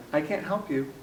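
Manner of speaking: soft, mock-polite refusal; quietly dismissive under a polite form; unhelpful tone. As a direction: imitate a maitre d' turning someone away. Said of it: "the tone, though, was more like".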